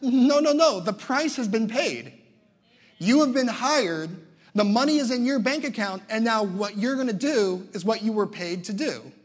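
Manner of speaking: loud and firm, the deal is done; insistent loud. Pretend. Preacher voicing movers, loudly insisting the job is paid. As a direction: hammer it home that it's paid for.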